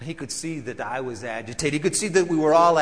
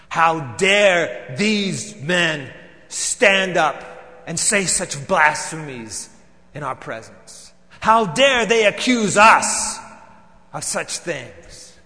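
slow, louder, biting each word; indignant outrage; 7 dB louder, slower than usual. Acted. Pharisee reliving his outrage to Pontius, slow and loud.

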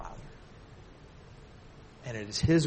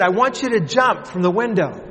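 father instructing; 6 semitones higher, 8 dB louder than usual. Retold or imitated. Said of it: "He said".

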